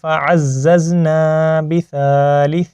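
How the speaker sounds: slow, drawn-out Quranic recitation. Shown as a demonstration. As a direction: recite slowly with long held vowels. (ٹھہر ٹھہر کر تلاوت)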